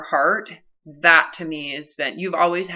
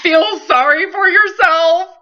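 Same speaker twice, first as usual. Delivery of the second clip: hammed-up whimper, much higher and slow; mock crying; 12 semitones higher, 7 dB louder, slower than usual. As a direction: sob it out in a teasing, pitiful voice. Mocking self-pity, voice crying.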